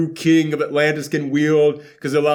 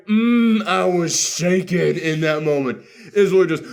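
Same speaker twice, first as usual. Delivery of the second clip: ordinary talk, nothing marked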